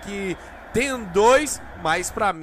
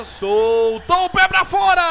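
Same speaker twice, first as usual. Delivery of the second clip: excited miss call; 8 semitones higher, 5 dB louder than usual. Real, not acted. Penalty miss called.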